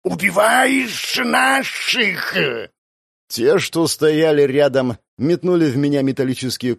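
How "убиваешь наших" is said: slow hiss of a lizard creature; hissing demon. Acted. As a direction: hiss it out like a reptile. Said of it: "прошипел кто-то из демонических отродий".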